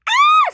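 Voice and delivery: piercing high scream. Acted. Rosie sees the scrap monster, shrill scream. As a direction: shriek very high and loud. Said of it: "screamed Rosie".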